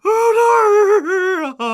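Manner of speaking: slow, high wail of grief; keen over the dead son; father wailing over his son's body; grieving wail. Acted.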